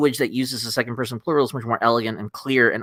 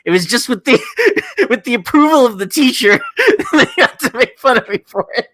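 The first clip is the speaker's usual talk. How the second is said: higher, louder, breaking into laughs; words bubbling up through laughs; laughing while talking; 13 semitones higher, 6 dB louder than usual. Real, not acted. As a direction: tell it through helpless laughter. Laughing while recounting being mocked.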